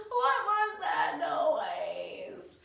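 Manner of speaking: put-on slow, loud, stretched-out child's wail; frightened wail. Little girl asks grandma about the noise, slow wail. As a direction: draw every word out fearfully.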